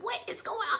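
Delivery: high-pitched mock dismay; baffled alarm. Dad grabbing his son off the curb, alarmed and baffled. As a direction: imitate a parent's high, startled question.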